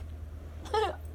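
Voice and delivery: a soft unvoiced laugh, choked up; emotional breathy laugh. Real, not acted. Reader moved while saying the affirmation, emotional laugh. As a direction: laugh through rising emotion. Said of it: "Oh, I'm getting emotional".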